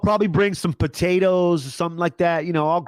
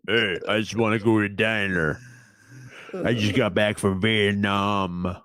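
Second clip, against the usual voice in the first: Rambo impression; 7 semitones lower, 3 dB quieter than usual. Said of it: "he talks like this".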